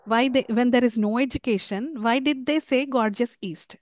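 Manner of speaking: ordinary talk, nothing marked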